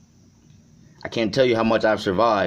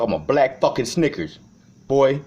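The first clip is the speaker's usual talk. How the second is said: ordinary talk, nothing marked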